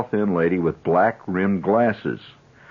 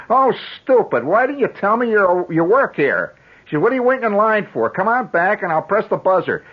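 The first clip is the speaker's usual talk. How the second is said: much higher, louder fussy lady scolding; chiding service clerk; 11 semitones higher, 3 dB louder than usual. Retold or imitated. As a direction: scold the boy like a flustered clerk. Clerk scolds boy in line.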